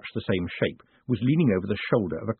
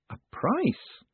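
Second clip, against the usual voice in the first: much higher, innocent "A price?"; mock surprise; 3 dB quieter than usual. A narrator's reading. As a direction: feign astonishment. Moist feigns surprise.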